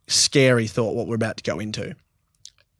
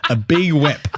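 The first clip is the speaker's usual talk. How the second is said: laughed-out nickname; laughing; 7 semitones higher, 4 dB louder, slower than usual. Real, not acted. Laughing while giving his girlfriend a nickname. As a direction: say it through a real laugh.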